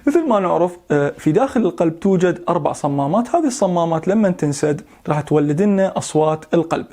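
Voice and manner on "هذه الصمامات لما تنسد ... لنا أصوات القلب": usual speaking voice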